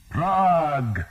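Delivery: high, loud shout; war cry. A narrator's reading. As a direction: hurl the name as a rallying cry. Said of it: "they shouted their war cry".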